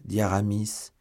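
usual speaking voice

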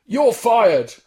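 high, loud mock TV judge; Simon Cowell. Comedian doing Cowell on request, loud high impression. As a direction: punch out a bad impression, loudly. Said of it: "Simon Cowell".

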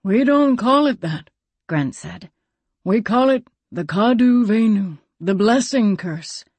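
a hint of a weathered elder; old man Grant. Grant voiced by narrator.

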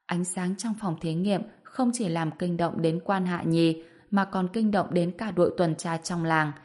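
usual speaking voice

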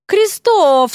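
loud, high commanding call; commanding shout. A narrator's reading. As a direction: bark the name like an order. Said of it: "скомандовала она".

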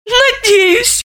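high, tiny squeaked reply. Acted. Timid suspect answering detective, squeaky. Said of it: "пискнула Заматаева".

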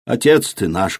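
plain reading voice

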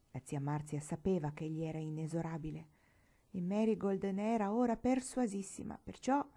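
ordinary delivery, nothing marked

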